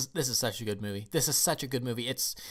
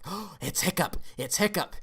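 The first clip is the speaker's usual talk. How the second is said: higher, eager mock gasp of recognition; excited fans; 6 semitones higher than usual. Viewers spotting the hero on screen, mock excited. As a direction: imitate viewers thrilled to spot someone. Acting out delighted viewers' reaction.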